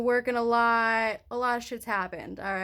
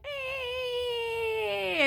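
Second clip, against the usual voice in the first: whimper; 12 semitones higher, 5 dB quieter than usual. Retold or imitated. Mimics shaky-handed self at gas station.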